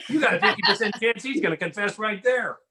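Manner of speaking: ordinary talk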